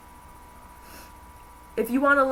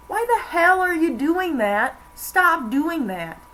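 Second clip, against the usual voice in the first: higher, louder, slow incredulous scold; mock-scolding, pitched way up; exasperated retelling; 8 semitones higher, 4 dB louder, slower than usual. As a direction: demand it like it's obvious, drawn out. Paraphrasing the guy's rant.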